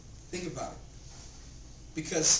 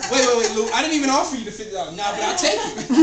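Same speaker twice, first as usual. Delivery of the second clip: loud, high retold protest giving way; mock startled objection; 5 semitones higher, 11 dB louder, faster than usual. Answering Lou's fifty dollar offer, mock startled objection. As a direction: cut him off in playful alarm, then cave.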